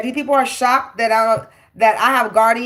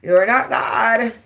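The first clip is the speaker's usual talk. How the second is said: ordinary talk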